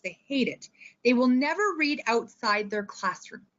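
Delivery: ordinary delivery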